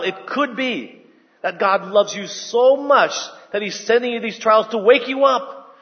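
ordinary talk

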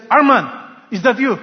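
loud, high, urgent call; father shouting down to his buried son. Acted.

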